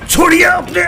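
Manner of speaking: high, straining order; urgent command. Acted. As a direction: shout while pulling hands away. Officer pulls hands off major's throat. (घबराया हुआ आदेश)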